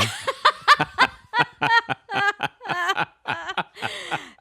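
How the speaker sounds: shrieking laugh way up high; high burst of laughter. Real, not acted. Wife bursts out laughing at the toddler's calm advice, high shrieking laugh.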